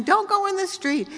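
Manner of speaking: yelling warning. Retold or imitated. Grandfather warning toddler off street, high yell.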